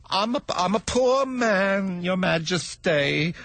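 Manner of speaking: high, slow stammering fear; frightened hatter begging the king; trembling voice. Acted. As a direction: plead in a mock trembling voice. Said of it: "the hatter began in a trembling voice".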